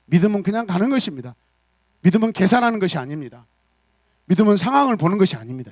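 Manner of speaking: loud, high declarations of faith; forceful preaching. Acted. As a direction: proclaim each line with conviction.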